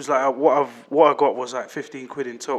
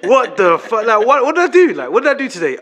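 incredulous; 7 semitones higher, 8 dB louder than usual. Real, not acted. Laughing disbelief at bad luck.

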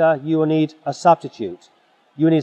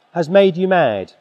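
normal talking voice

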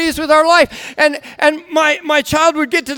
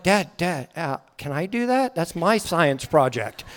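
soft, hesitant child imitation; timid interruption; 6 semitones lower, 9 dB quieter than usual. Child reclaiming science project from dad, meekly. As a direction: quietly try to get dad's attention.

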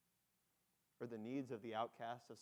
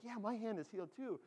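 higher-pitched, eager agreement; excited Samaritan; 9 semitones higher than usual. Acted. Samaritan walking along with the healed lepers, excited. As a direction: chime in with excitement.

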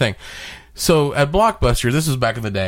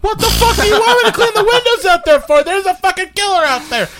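much higher, louder indignant yell; angry outburst; 5 dB louder than usual. Retold or imitated. Clerk yelling at boss about killer outside. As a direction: protest in outraged disbelief.

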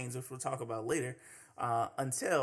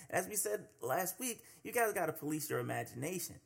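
ordinary delivery

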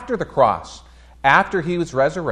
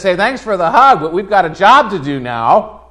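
louder, upbeat friendly brush-off; hearty, loud Jesus; 4 semitones higher, 9 dB louder than usual. Acted. Jesus telling Mary there's a job to do, hearty and loud. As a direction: boom it out like a cheerful coach.

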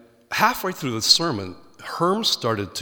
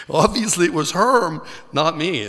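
laughing through the words, higher and louder; 7 semitones higher, 5 dB louder than usual. Real, not acted. Preacher joking to the congregation about dodging the call: laughing. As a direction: let the laugh carry the line.

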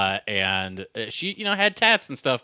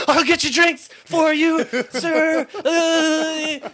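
very high, louder, laughing fake deference; awkward servile; 6 dB louder, much slower than usual. Barista serving the director after the awkward discovery, mock servile. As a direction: squeak it out, mortified and overly polite.

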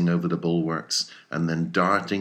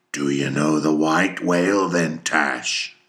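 louder, pressing question; urgent captain; 6 dB louder than usual. A narrator's reading. Captain presses Tashtego about the whale, urgently. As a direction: demand an answer.

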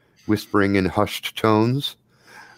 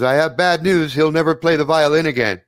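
raised, higher announcing voice; projected mock-grave announcement; calling over; 6 semitones higher, 3 dB louder than usual. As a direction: call the news across the room. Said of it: "calls over to Jack".

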